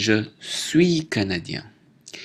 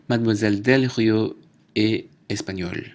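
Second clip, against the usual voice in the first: ordinary talk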